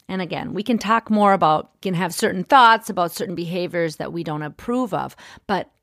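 ordinary talk

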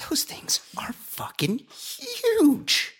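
drawn-out, breathy amazement; slow, airy, swooping emphasis; hushed awe. Real, not acted. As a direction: stretch it out slowly in wonder. Awed remark on polar bears.

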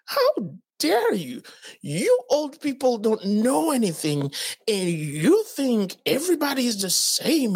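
high, slow indignant how dare you; mock-indignant young man; high, slow indignant reply to the old neighbor; indignant retort. Retold angry retort to neighbor.

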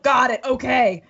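loud, brisk acknowledgment; acting out a boss snapping to it; freshly woken boss. Boss takes in the whispered news, loud and decisive. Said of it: "and for him to go".

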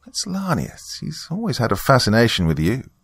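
high-pitched knowing villainess; Margaret. Acted. Church member answering the confused guest, high and knowing. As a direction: lift into a woman's confiding, teasing voice.

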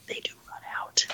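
hushed punchline whisper. Acted. Joking to listeners, whispering the punchline. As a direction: whisper the last words like a secret.

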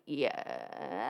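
a low, flat-out "Yeah" from the cop, lightly voiced; deadpan cop. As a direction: play the blunt cop, a deep short yes. Cop's blunt answer imitated.

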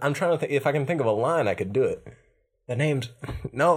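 ordinary talk

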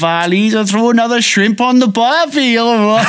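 high, hammed-up Welsh-Australian mash-up; mixed Welsh-Australian accent. Shown as a demonstration. Attempting two accents at once on a co-host's dare, high-pitched Welsh-Australian. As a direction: push Welsh and Australian together, breaking into laughter. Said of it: "those are two accents that you can do".

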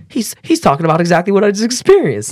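high-pitched excited disbelief; amazed realization. Retold or imitated. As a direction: stammer it out high, thrilled at the discovery. Amazed reaction to a podcast.

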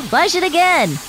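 high-pitched call back. Acted. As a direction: call the order across the house. Calling back to other dog.

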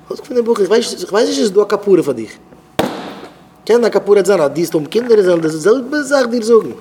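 high-pitched, acted-out scolding; indignant rebuke. Scolding a boy over his parent, indignant and high.

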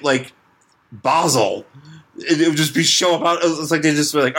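usual speaking voice

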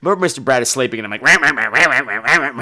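low, squawking grumble; imitated grouchy quacking; talking like the Penguin. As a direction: gabble angry nonsense like a cartoon villain. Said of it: "talking like the penguin".

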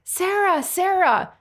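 high-pitched re-enacted calling out; mock lunatic yell. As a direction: call the name frantically high, without full volume. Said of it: "start yelling like a lunatic".

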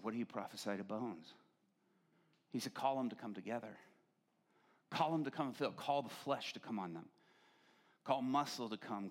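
low, soft, solemn storytelling with long pauses; hushed vision narration. Real, not acted. Preacher telling the congregation a vision, hushed and solemn. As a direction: recount it gravely, quiet and low.

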